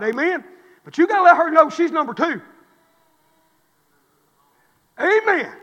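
loud, high, driving preacher's cry; full-force preaching. Acted. Preacher pressing husbands on home life, at full force. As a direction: preach it hard and raise the roof.

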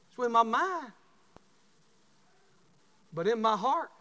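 high, loud preacher's cry; preaching at full force. Acted. Pastor confessing to the congregation, full-force preaching. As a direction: hammer it home to the church.

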